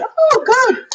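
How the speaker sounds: high, loud retold cheer. Mother retells doctors cheering over the placenta, high mock cheer. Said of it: "I remember them cheering".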